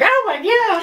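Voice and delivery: a sharp, high yelp of fright; startled shriek. Retold or imitated. Examiner shrieks as kid jumps out, startled. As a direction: shriek it high, jolted by a sudden scare.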